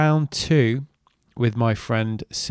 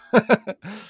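short high laugh; 10 semitones higher than usual. Real, not acted. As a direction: laugh it off, a bit rueful. Laughs at missing the event.

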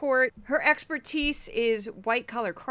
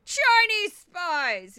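drawn-out, loud, higher "Chinese spies!"; mock shout; 8 semitones higher, 6 dB louder, much slower than usual. Mocking the host's favorite line with a loud shout. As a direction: bellow "Chinese spies!" slowly like a catchphrase. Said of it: "It's, like, in her".

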